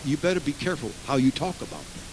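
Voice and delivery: normal talking voice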